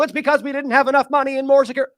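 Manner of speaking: hammed-up whiny official; mock official. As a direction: sneer at their excuses in a silly voice. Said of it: "and they go".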